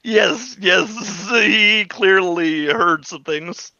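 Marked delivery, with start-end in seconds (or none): none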